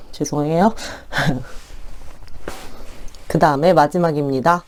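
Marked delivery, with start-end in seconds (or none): 0.8-3.2 soft breathy laugh. Real, not acted. Laughs at own joke.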